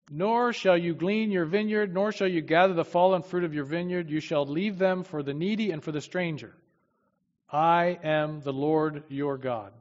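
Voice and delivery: scripture reading. Acted.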